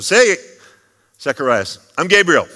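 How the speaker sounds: loud, high angelic greeting; angel announcing himself. Acted. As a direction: boom out the angel's hello. Angel Gabriel greets Zechariah.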